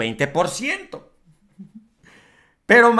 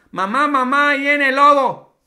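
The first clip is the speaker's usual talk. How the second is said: put-on a child calling out louder and higher for his mom; little boy shouting the wolf is coming; 6 semitones higher, 5 dB louder than usual. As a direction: call mom like a boy faking a wolf alarm. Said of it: "Le decía el niño a la mamá".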